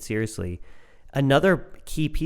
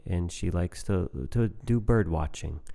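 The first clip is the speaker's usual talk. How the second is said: calm, boring description; 4 semitones lower, 4 dB quieter than usual. Retold or imitated. Husband describing his wife with no feeling, flat and bored. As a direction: drone it out like reading a spec sheet. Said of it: "And you just very calmly say".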